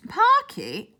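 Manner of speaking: high, puzzled repeat. A narrator's reading. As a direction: echo the odd word, baffled. Puzzled at strange word.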